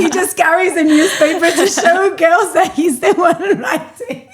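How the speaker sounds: real laughter running through the words; laughing. Real, not acted. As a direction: tell it while cracking up. Laughing at the memory.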